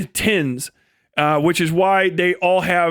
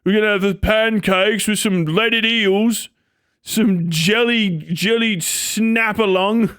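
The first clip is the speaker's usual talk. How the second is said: mock British family; 2 semitones higher than usual. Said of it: "it'd be like".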